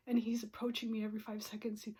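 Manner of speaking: ordinary delivery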